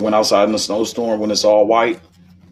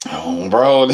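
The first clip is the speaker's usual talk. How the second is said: ordinary talk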